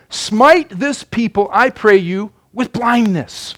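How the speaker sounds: slow, drawn-out solemn plea; prophet's prayer. Acted. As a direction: pray it slowly and deliberately. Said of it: "Elisha prayed unto the Lord and said".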